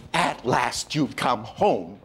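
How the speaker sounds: very slow, milked line; working too hard. Shown as a demonstration. Teacher mocking overdone Hollywood reunion, dragged out. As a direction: overplay every word, syrupy. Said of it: "it's working too hard".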